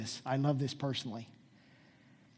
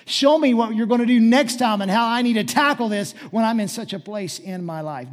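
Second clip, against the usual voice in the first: loud, raised, insistent plea; urgent preached prayer; 6 semitones higher, 12 dB louder than usual. Acted. Pastor asking God how to tackle this, urgent preaching. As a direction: press the request home forcefully.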